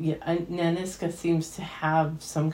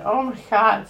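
moved exclamation; 5 semitones higher, 6 dB louder than usual. Real, not acted. Reacting to a moving scene.

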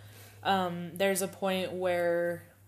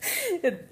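high laugh; 11 semitones higher than usual. Real, not acted. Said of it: "laughs mid quote".

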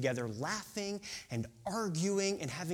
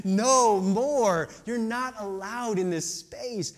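mock firm refusal; 4 dB louder, slower than usual.

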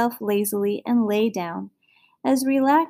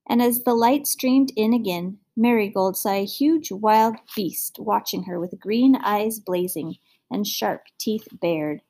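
ordinary delivery

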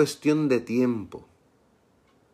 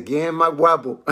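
raised, cocky mock dare; jocular challenge; 4 semitones higher than usual. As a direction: taunt them playfully, on the edge of laughing. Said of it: "como que está siendo medio jocoso ahí". (desafío burlón y jocoso)

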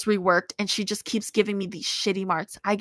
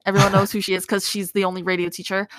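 ordinary talk, nothing marked

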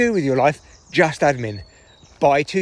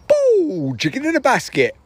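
comic's catchphrase; 10 semitones higher than usual. Retold or imitated. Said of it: "and then go".

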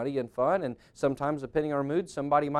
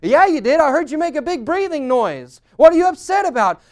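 very loud, high, hammed-up outrage; quarrelsome accusation; 11 semitones higher, 12 dB louder than usual. Spouse insisting they heard a sigh, shouting indignantly. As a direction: escalate into a shouted mock argument.

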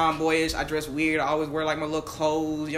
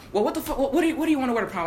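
exasperated mom; 8 semitones higher, faster than usual. Retold or imitated. Frustrated mom voiced in story.

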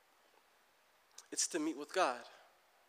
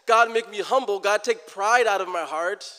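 louder, earnest-sounding pretend prayer; mock humble prayer; 4 semitones higher, 9 dB louder than usual. Preacher voices a prayer no one says, loud mock plea. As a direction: plead loudly like a prayer nobody prays. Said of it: "We don't say that".

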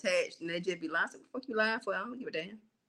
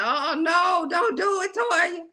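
warning shout; 6 semitones higher, much slower than usual. Retold or imitated. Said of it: "I was like".

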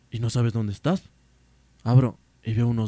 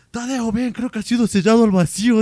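high, loud mock-alarmed call; acted-out drunken alarm, loud and high; calling for help; 10 semitones higher, 8 dB louder than usual. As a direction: summon a friend urgently, voice pitched up. Calls friend over, panicked about the door.

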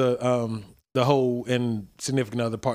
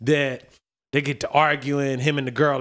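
ordinary talk

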